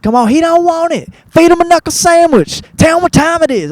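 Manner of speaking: improvised fight cheering. Retold or imitated. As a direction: egg on a schoolyard fight, shouting one jab after another. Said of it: "just hitting it with one after the other".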